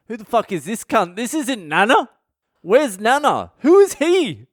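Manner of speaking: horrified family finding a stranger in the coffin; shocked outburst. Retold or imitated. Family opens box, wrong body.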